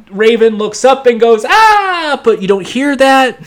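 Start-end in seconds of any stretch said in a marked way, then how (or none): 1.4-2.2 very high sharp "Ah"; startled scream. Retold or imitated. Raven waking from a nightmare, startled scream. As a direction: yelp in fright.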